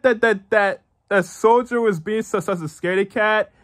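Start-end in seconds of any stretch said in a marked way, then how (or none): none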